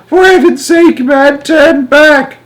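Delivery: very high, loud, drawn-out screech; grandma's yell. A narrator's reading. Grandma ordering Wonka back, yelling. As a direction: yell it slowly, desperate to turn back. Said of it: "yelled Grandma Georgina".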